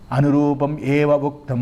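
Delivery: low, slow sung intonation; Sanskrit text recitation. Acted. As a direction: hold the last syllable on one note. (సంస్కృత పఠనం, రాగయుక్తంగా)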